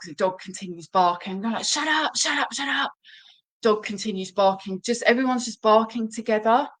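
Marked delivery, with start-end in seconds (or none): 1.6-2.9 mock shout at the dog. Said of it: "we shout at the dog".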